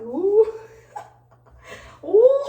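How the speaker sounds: high, loud delighted ooh; a loud, high whoop of glee; excited ooh. Pretend. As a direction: squeal it like gliding backwards on ice. Showing off skating backwards.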